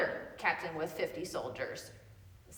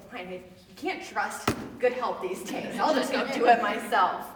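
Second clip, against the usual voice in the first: exasperated Saul; 9 dB louder than usual. Retold or imitated. Retold Saul, fed up with messengers.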